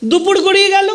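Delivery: rising storyteller's cry. A narrator's reading. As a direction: call the line out to the listeners. Narrator repeats, second raised.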